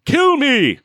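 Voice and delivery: high, loud, hammed-up plea; mock crazed reverend.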